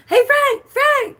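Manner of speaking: high, loud calling mom. Retold or imitated. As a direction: call up the stairs like mom. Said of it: "she walked down the other day and was like".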